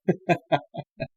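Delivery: short, soft chuckle; laugh. Real, not acted. Dating coach amused at women's six-inch heels demand, laughing. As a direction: let out a quick, quiet laugh.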